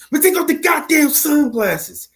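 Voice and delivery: Denzel impression. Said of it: "Denzel might not have had his voice like that down pat".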